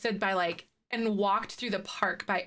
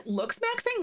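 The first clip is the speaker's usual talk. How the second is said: much higher, baffled "Looks maxing?"; surprised question; 11 semitones higher than usual. Real, not acted. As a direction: ask "Looks maxing?" in disbelief. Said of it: "repeats the phrase puzzled".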